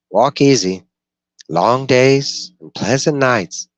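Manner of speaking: high, loud, slow parting blessing; Brown's farewell. Acted. Dweller Brown bidding the gunslinger farewell, high, loud and slow. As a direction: send him off high and drawn out.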